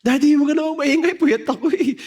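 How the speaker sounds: mataas na boses ng nagrereklamong asawa; asawang inaantok. Retold or imitated. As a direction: gayahin ang asawang nakikiusap ng katahimikan. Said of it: "Yung asawa ko po yan". (mataas at pareklamong boses ng misis)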